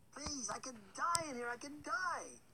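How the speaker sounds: high pleading voice. A narrator's reading. Baker woman pleads with Hansel in fear. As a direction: plead desperately.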